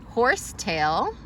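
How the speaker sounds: ordinary delivery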